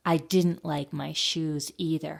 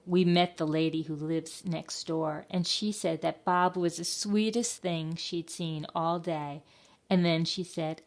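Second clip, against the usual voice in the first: plain reading voice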